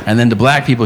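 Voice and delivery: normal talking voice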